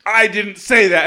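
acted-out defensive objection; retold protest. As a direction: protest slowly, imitating his defence. Acted denial in retold quarrel.

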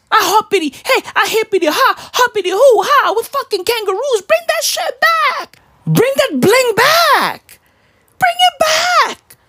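very high, squealing comic rant; hammed-up demand. Mock Australian demanding his ring back, high-pitched hammed-up outburst.

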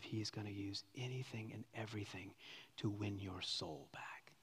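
hushed whisper, slowing at the end. Real, not acted. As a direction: breathe it out like a secret. Preacher drops to hushed climax.

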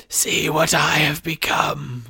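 slow, high, breathy villain voice; sinister face. A narrator's reading. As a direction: draw it out in an eerie hiss. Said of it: "the face said".

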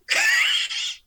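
high, loud real laugh; a sudden real burst of laughing; burst of laughter. Real, not acted. Laughs at Phil's reply.

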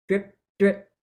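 "drip, drip" as short separate drops; dripping articulation. Shown as a demonstration. Teacher linking dit to a faucet, "drip, drip" short and light. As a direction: say each "drip" quick and detached. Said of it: "Every time you see dit".

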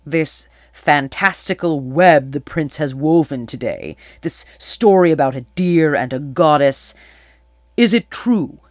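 loud, weighty royal questioning, rising on the last question; demanding king. A narrator's reading. King questions Atalanta about the prince's story, loud demand. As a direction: press for the truth with force.

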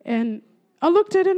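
ordinary talk

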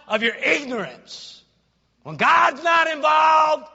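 loud, high pulpit proclamation with stretched phrases; full-force preaching. Real, not acted. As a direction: preach it out in ringing shouts.

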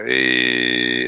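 a low, drawn New York accent hey. Shown as a demonstration. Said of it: "in the voice".

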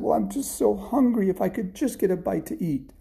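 high, small begging voice; pleading hungry boy. Acted. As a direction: plead pitifully for a morsel. Hungry Jack begging for food.

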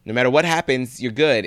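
high, loud and fast mock urging; impatient urging. Bert hurrying Kurt to open the letter, hammed-up. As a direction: push him to just open it.

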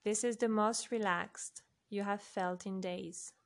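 ordinary delivery

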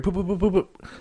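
soft high popping sounds; popping noises. Retold or imitated. Describing all the Meeseeks disappearing, soft high popping noises.

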